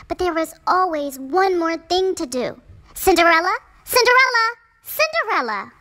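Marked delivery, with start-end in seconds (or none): 2.9-5.7 loud, high repeated calls; family calling for Cinderella, loud and high. A narrator's reading. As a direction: call her name again and again.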